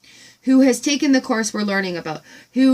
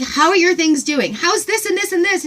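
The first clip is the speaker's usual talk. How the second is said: higher and louder rapid excited questions; excited gush; 8 semitones higher, 3 dB louder than usual. Retold or imitated. Retelling meeting the farm woman, gushing excitedly. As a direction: gush like you just met a kindred spirit. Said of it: "I'm like".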